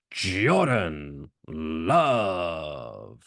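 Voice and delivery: drawn-out ring introductions; big announcer cadence, words held long. Acted. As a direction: stretch each name out like a fight announcer.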